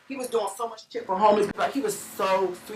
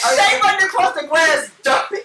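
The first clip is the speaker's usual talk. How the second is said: laughing retelling; 7 semitones higher, 8 dB louder than usual. Retold or imitated. Retelling the gunpoint scramble.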